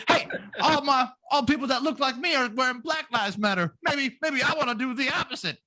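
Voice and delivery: [name] voice. Retold or imitated. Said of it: "he's like".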